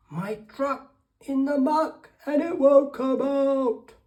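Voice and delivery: high, loud duck calling for help; shout. A narrator's reading. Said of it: "the ear that hears the shout".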